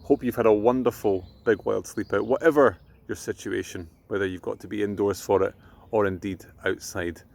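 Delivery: ordinary delivery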